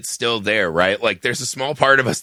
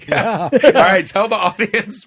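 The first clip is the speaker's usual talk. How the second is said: laughing; 8 semitones higher, 4 dB louder, slower than usual. Real, not acted. Laughing at the movie pick.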